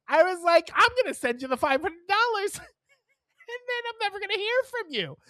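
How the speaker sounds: high, laughing through the words; laughing as she tells the scam landlord no, pitched high; laughing disbelief. Retold or imitated. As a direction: call out the scam while cracking up. Laughing off the scammer on phone.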